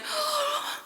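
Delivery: sharp, high frightened gasp. A narrator's reading. Witches seeing the dough swell, gasping in fright. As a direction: suck in air in sudden fright.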